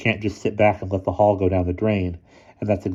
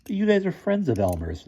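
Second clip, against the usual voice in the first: puzzled; 11 semitones higher than usual. A narrator's reading.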